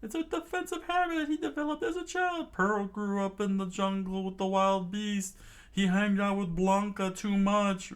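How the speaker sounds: high, frantic group-panic voice; shrill-pitched alarm easing into high explanation; crew screaming. A narrator's reading. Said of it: "the crew screamed out".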